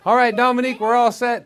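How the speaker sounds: loud, high call through the door. Real, not acted. Host calling to Dominique in her dressing room, loud and high. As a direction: announce it loudly through the door.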